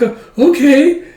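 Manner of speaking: high, loud, stretched agreement; flustered delight. Retold or imitated. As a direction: agree while giddy about the date. Flustered Frankie accepts the date.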